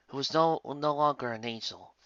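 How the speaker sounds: ordinary delivery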